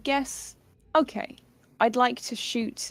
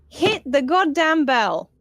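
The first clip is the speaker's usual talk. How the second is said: loud command; 3 semitones higher, 5 dB louder, slower than usual. Acted. Player yelling at teammates to hit the bell, loud and slow. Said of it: "Except not that way".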